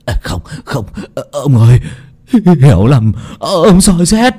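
put-on mildly whimpering frightened old man; whimpering plea. Healer begging the husband it's a misunderstanding, whimpering. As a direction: plead your innocence in a shaky whimper. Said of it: "mếu máo thanh minh".

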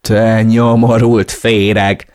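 loud, drawn-out insult; angry shout. A narrator's reading. Narrator bursts in on Tony, shouting furiously. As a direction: hurl the insult slowly at full volume. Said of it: "Ordítottam".

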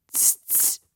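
soft wordless smacking clicks; animal lip-smacking. A narrator's reading. Animal facing the man on the beach, quiet smacking. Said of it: "schmatzte eines der Tiere".

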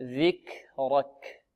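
ذكرك slow and soft, air after ك; correct breathy kaf. Shown as a demonstration. Teacher showing students how, soft aspirated kaf. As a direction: release a stream of breath on ك in ذكرك. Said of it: "هكذا".

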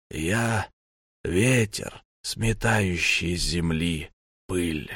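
slow, creaking necromancer voice; creaky voice. A narrator's reading. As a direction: grate the words out slowly. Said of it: "проскрипел некромант".

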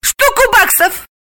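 very high, slow eager exclamation; greedy high-pitched bid; blurting out. A narrator's reading. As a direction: blurt out your price without thinking. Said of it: "не раздумывая, выпалила Галина".

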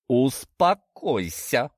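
slow, drawn-out firm command; stern. A narrator's reading. Father ordering his daughter to calm down, stern. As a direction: order it firmly, stretching the word. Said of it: "строго произнёс барашек Егор".

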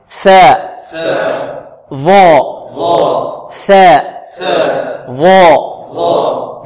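ثاء short, ظاء long and drawn out; letter drill. Shown as a demonstration. Teacher drilling the class on ثاء and ظاء. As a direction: say ثاء crisply, ظاء stretched. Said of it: "انطقوا معي".

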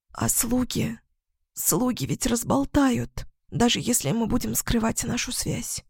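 очень тихо, высоким голосом; испуганно шепчет; едва слышный шёпот. A narrator's reading. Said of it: "прошептала она едва слышно". (едва слышный шёпот феи)

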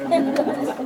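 loud, long laugh breaking over the word; hearty laughter. Real, not acted. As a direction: laugh hard mid-story. Laughing at the colonel story.

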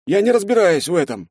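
put-on a scared young sergeant answering; frightened soldier. A narrator's reading. (испуганный солдат, высоким голосом)

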